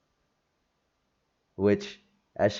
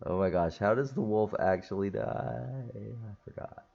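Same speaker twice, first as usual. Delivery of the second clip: thinking aloud; 8 dB quieter than usual. Real, not acted. Forgets plot, thinks aloud to self.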